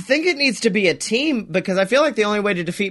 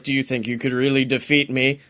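lightly voiced villain, dropping much lower; a hint of a menacing boss, sinking low and soft; 8 semitones lower, 3 dB quieter than usual. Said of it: "and Pizza the Hut's like".

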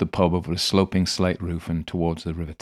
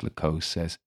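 ordinary delivery, nothing marked

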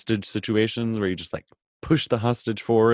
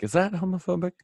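mock worried question; 9 semitones higher than usual. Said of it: "I was like".

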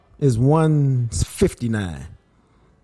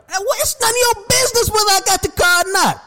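high indignant retort; faster than usual. Retold or imitated. Retorting to nosy rep.

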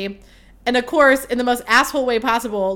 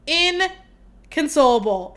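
"Inconsolable" stretched very slowly, pitched higher; drawn-out emphasis; 5 semitones higher, much slower than usual. Real, not acted. Telling listeners how upset she was, "Inconsolable" drawn out for emphasis. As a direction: draw out every syllable of "Inconsolable", voice lifted.

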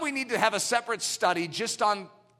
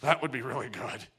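tempted longing; 8 semitones lower, 4 dB quieter than usual. Retold or imitated. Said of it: "We're like".

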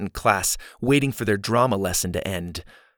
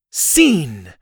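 loud, raised announcement; higher, louder one-word call; 5 semitones higher, 6 dB louder than usual. A narrator's reading. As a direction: call it out theatrically. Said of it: "she called from the ground".

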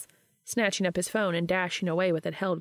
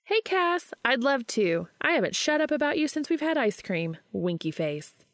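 higher voice, mock-formal reading of a text; Charlie, teasing friend; 7 semitones higher than usual. A narrator's reading. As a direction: tease him by reading it out. Charlie typing reply mischievously.

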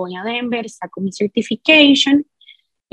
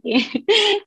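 short laugh; 10 semitones higher than usual. Real, not acted. Laughs at illegal classes.

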